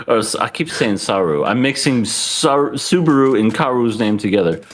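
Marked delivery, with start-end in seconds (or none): none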